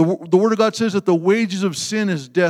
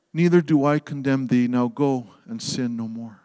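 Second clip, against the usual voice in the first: forgiving Jesus; 6 semitones lower, 4 dB quieter than usual. Acted.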